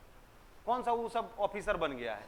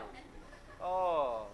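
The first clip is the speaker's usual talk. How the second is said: ordinary talk, nothing marked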